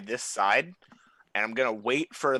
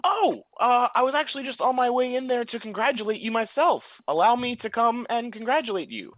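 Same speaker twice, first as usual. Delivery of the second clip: calling out; 12 semitones higher than usual. Acted. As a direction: call out to the goblin, all false friendliness. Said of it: "I'm gonna shout out like".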